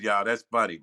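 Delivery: normal talking voice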